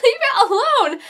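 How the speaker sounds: high-pitched retold outburst; exasperated plea. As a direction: plead with the screen, worked up. Viewer pleading at the screen.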